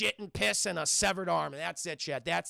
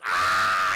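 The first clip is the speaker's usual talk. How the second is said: loud low scream of terror; 12 semitones lower, 11 dB louder than usual. Retold or imitated. Chad screams as the blob falls on him. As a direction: scream as the monster drops.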